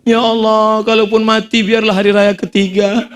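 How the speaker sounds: high, comic plea to God; mock pleading prayer. New-clothes buyer begs God to delay death, mock pleading.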